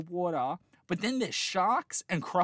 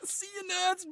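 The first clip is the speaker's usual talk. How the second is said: high, cheeky parting jab; mock taunt; 13 semitones higher than usual. Shoving past the family, she taunts them in a high cheeky voice. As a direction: toss it off smugly. Retelling pushing the family away.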